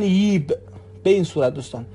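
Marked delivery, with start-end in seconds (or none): 0.0-0.5 ییب with a y sound instead of j; Iraqi pronunciation. Shown as a demonstration. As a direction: say ییب, the ج turned into ی. (تلفظ عراقی ییب با ی به جای ج)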